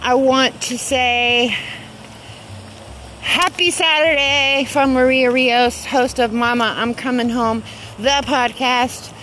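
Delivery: ordinary talk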